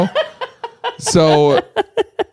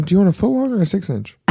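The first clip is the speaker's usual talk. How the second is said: put-on quick, high, soft teen cashier voice; bored teen clerk; 8 semitones higher, 4 dB quieter, faster than usual. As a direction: rattle it off like a bored teen worker. Teen subway worker voiced.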